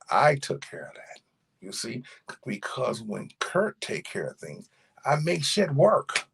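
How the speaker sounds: normal talking voice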